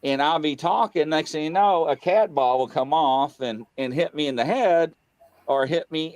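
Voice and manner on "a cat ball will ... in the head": ordinary talk, nothing marked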